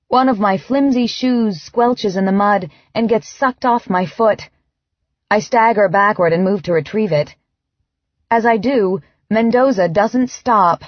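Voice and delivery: plain reading voice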